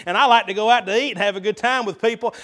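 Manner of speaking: usual speaking voice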